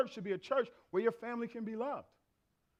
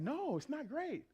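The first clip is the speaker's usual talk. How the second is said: 1 flat retort; 7 semitones higher, 4 dB quieter, slower than usual. Retold or imitated. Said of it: "I'm like".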